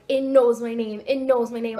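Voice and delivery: mock-alarmed, punchy repetition; mildly freaking out. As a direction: recount your fright with emphatic, insistent force. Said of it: "I started freaking out".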